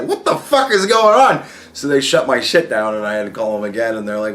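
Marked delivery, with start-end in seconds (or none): 0.0-1.4 mock alarmed shout. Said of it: "my credit card company was like".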